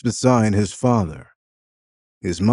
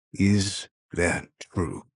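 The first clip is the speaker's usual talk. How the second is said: "Is" stressed, said slowly and heavily; slow pointed question; 6 dB quieter, slower than usual. A narrator's reading. Father pinning his son down, slow heavy question. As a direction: drag out "Is that true" to pin him down. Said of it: "He emphasizes".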